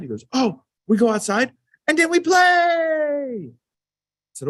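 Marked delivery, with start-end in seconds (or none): 0.3-3.5 put-on very high, slow, loud toddler cheer. Dad imitating toddler son's happy answer, high and slow. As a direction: sing it out like a happy two-year-old.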